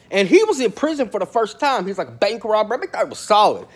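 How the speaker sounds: normal talking voice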